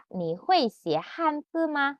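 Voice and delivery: "你会写汉字吗" slow and clear; slow, for copying. Shown as a demonstration. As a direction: say it for Kak Rika to echo. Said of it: "Ikutin, Kak Rika".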